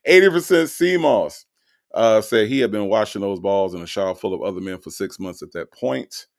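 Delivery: usual speaking voice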